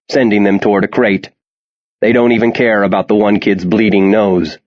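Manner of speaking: ordinary delivery, nothing marked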